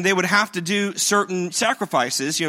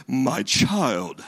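solemn movie Jesus; 6 semitones lower than usual. Retold or imitated. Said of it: "stoic and always put together".

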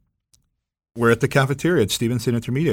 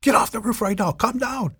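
retold yell; 12 semitones higher than usual. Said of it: "Police are yelling at her".

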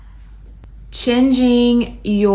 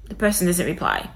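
ordinary talk

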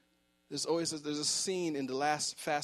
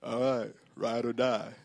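Vin Diesel; 3 semitones lower, 4 dB louder than usual. Retold or imitated. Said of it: "when Vin Diesel says".

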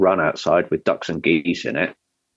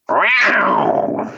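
sudden high yowl like a big cat; big cat call; 13 semitones higher, 3 dB louder than usual. Retold or imitated. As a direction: let out a loud cat cry. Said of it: "an impression of a big cat".

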